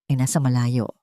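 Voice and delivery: ordinary delivery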